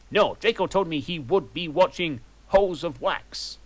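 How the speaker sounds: a hint of a fussy teacher; professor voice. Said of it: "said Professor Trevelyan".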